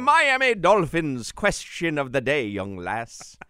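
lightly put-on Irish accent, slow; a playful stab at an Irish lilt; hint of a stage Irish brogue; mock Irish. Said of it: "Is that Irish?".

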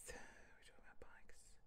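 hushed whisper to herself. Real, not acted. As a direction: whisper it as a reminder. Whispered aside.

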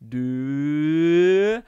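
slow, stretched announcer title; drawn-out segment title. Acted. Podcaster announcing his segment title, slow and drawn out. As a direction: announce the segment name slowly.

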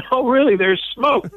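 put-on high insistent kid's voice; a little boy pleading to be believed; imitating child. Boy insisting to his mom there's smoke, imitated high. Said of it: "He said".

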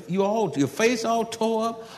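ordinary talk, nothing marked

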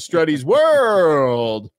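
loud, long-stretched last word; segment sign-off. Real, not acted. Host closing the story segment, drawn-out announcer call. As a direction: announce the show title with a big drawn-out finish.

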